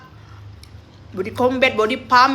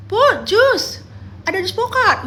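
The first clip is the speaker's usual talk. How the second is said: calling out; 10 semitones higher, 4 dB louder, slower than usual. Retold or imitated. Imitating gym friends calling out.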